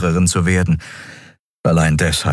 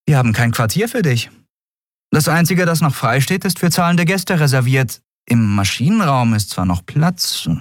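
high-pitched captain's voice; higher character voice; woman captain explaining; 9 semitones higher than usual. Acted. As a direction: explain the lodging problem in a high voice.

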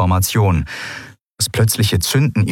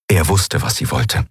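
usual speaking voice

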